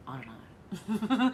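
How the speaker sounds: giggling through I don't know; laughing. Real, not acted. Streamer laughs off a chatter's remark about her voice, giggling. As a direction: laugh it off, bemused.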